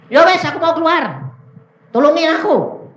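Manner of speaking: high, loud cry for help; acted-out desperate begging; loud pleading. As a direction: plead at the top of the voice. Voices spirit begging to leave.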